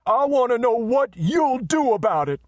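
very high, loud menace; threatening. Acted. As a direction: stride in and demand an answer. Messenger advances with clenched fists.

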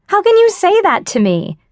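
mock-outraged friend; offended outburst. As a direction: act wounded and scandalized. Best friend insulted her.

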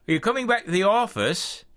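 put-on Rosemary checking quickly, British accent. A narrator's reading. Rosemary voiced on phone.

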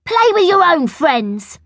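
high-pitched, dismissive schoolboy; acted childish brush-off, high voice; bossy refusal. A narrator's reading. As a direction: send the pest away. Bertie rejects Angela in a quarrel.

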